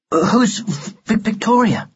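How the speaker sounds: put-on high, hesitant boy's question; nervous stammer. Ned asks the doll about Victoria, stammering. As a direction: catch on the V of Victoria.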